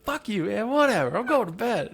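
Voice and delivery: high, hammed-up annoyed roommate; mock annoyed. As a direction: brush him off and storm to bed. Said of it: "Logan's just like".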